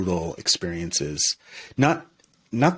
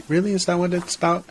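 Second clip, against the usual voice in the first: lightly voiced inner doubt, pitched much higher; quiet inner voice; 10 semitones higher, faster than usual. Inner voice questioning the speaker's jokes about meat, quiet inner voice. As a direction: question yourself in a high, small inner voice. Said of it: "this sort of quiet inner voice".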